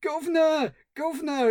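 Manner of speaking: high, shrill-free Cockney wail of Governor; Cockney shouting Governor. Retold or imitated. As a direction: call out Governor in a high mock Cockney panic. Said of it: "runs around the place shouting".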